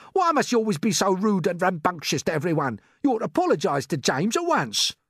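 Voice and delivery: high, indignant scolding; cross earthworm cry. A narrator's reading. Earthworm scolding centipede for rudeness, crying out indignantly. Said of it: "cried the earthworm".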